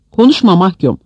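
mild shout, reprimanding; shouted rebuke. A narrator's reading. Baltar silences a prisoner who criticised state elders, shouting. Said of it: "diye bağırdı Baltar".

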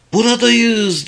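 narrated shout, pitched well up; excited shout. A narrator's reading. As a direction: call out high and joyful. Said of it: "diye bağırdım".

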